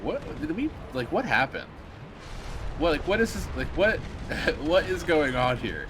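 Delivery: bewildered disbelief; mock bewildered. Streamer reacting to Phil's change to war news, mock baffled disbelief. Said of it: "And I'm like".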